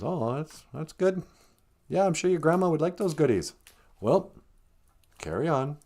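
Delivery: a hint of a too-casual wolf; lightly voiced casual wolf. Voices wolf feigning casual approval.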